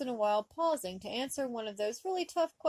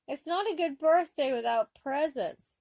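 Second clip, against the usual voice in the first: whining; 6 semitones higher, 6 dB louder than usual. A narrator's reading. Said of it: "Wesley whined".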